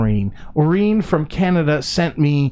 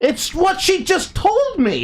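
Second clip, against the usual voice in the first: very high, drawn-out squeal; squealed protest. Real, not acted. Defending the pronunciation to co-host, squealed protest. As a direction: protest slowly in a high-pitched voice. Protests being corrected about the name.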